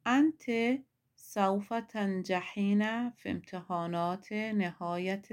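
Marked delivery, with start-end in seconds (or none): 0.1-0.5 انت said high and loud; emphatic. Shown as a demonstration. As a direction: hit the word انت hard, high and loud. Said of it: "انت یعنی تو یک زن".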